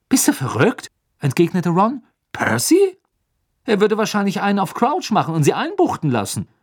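high, loud incredulous outburst; indignant Ron. A narrator's reading. Ron refuses to tell Percy, loudly indignant. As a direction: scoff at the very idea.

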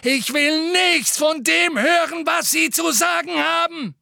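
put-on a boy's raw furious scream; furious shout. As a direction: scream it with everything. Harry continuing his bellow.

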